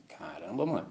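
hushed, low "Caramba, mano" as if struck dumb; stunned hush. Retold or imitated. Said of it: "o cara fala assim".

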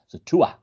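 "Tua" as "TOO-uh", no TH sound; Irish pronunciation. Shown as a demonstration. Guest shows the host the Irish way, no TH. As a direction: drop the TH, just "Tua". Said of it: "the Irish don't pronounce the T H sound".

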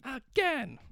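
high-pitched mock coach barking again. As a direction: play a drill coach demanding another try. Coach drills boys, demands answer.

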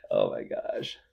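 ordinary talk

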